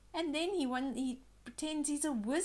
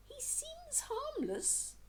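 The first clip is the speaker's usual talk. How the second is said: naive thought; 4 dB quieter than usual. A narrator's reading. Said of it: "thought Barnaby".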